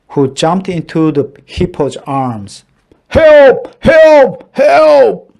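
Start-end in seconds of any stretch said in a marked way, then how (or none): 3.1-5.3 high, loud panicked calls; frantic cry for help. A narrator's reading. Hippo crying for help as it leaps away, panicked. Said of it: "cried the hippo".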